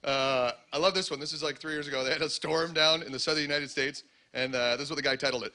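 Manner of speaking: ordinary talk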